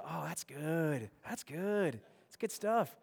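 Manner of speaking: slow, soft and relishing; mmm, slow and quiet savoring. Pretend. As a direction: linger over the taste. Savoring cold pizza.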